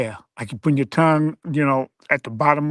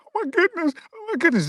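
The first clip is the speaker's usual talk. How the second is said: much higher, gasping mock dismay; mock shocked woman; 13 semitones higher, faster than usual. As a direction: fake being shocked and upset. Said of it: "hands over her face and said".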